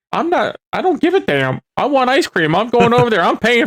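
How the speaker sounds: high, indignant insistence; defiant kid. Retold or imitated. Ten-year-old refusing Passover rules for ice cream, defiant.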